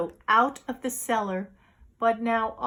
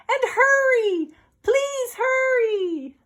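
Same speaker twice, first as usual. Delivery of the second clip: high, loud plea to hurry; pleading boy; 12 semitones higher, 5 dB louder, slower than usual. Acted. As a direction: beg them to rush.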